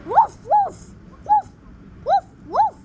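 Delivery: very high, loud little yaps; high dog woofs. Pretend. Doggo showing off his bark, high loud woofs. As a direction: bark like a tiny cartoon dog. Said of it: "Now I'm Doggo!".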